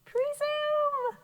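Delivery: PRESUME in a very high, squeaky-high pitch. Shown as a demonstration. Teacher leads class saying presume, high voice. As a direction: send presume way up high and hold it there. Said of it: "Say it high".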